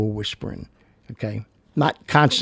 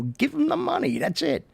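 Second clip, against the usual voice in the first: much higher, pleading burst; urgent plea. Real, not acted. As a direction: beg them to just hand it over. Urging: just hand over cash.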